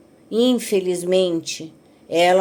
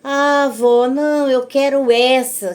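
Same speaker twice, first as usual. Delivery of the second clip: insistent granddaughter voice; 5 semitones higher, 5 dB louder, slower than usual. Retold or imitated. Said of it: "neta escolhendo roupa".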